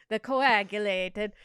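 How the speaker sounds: mock Italian accent drifting toward Swedish Chef; hammed-up Italian accent, slowing on the first words. Reading the memoir recipe aloud in a mock Italian accent. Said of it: "Should I be doing this in an Italian accent?".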